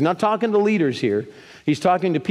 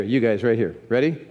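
usual speaking voice

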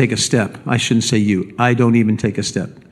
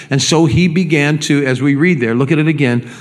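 ordinary delivery

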